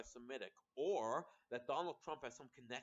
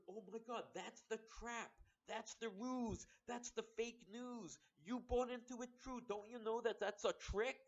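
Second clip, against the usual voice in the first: mock alarmed Trump; 8 semitones higher than usual. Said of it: "He heard".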